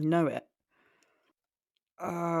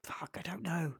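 whispered to self, unvoiced; 7 dB quieter than usual. Real, not acted. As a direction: breathe it out in frustration. Muttering while stuck on quiz.

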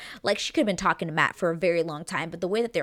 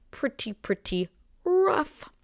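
"Pretty, pretty rough" slow and much higher, a mock wail; dramatic whine; 8 semitones higher, slower than usual. Shown as a demonstration. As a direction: stretch out "rough" like a mock lament.

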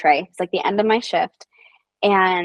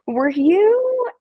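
slow high hesitant; 8 semitones higher, much slower than usual. Retold or imitated. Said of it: "And I was like".

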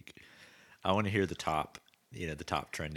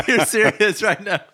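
loud, slow words through real laughter; laughing disbelief; 12 semitones higher, 15 dB louder than usual. Real, not acted. Listener cracks up at co-host's pastor act, laughing loudly. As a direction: say it while cracking up.

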